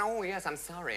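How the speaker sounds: high mock apology; apologetic. Mourners apologising to the vicar over the flag, apologetic.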